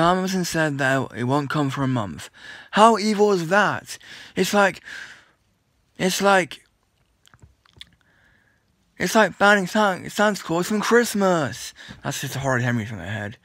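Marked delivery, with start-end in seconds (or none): 2.7-11.7 outraged. Real, not acted. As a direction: spell out the outrage slowly. Outraged at Amazon's delay.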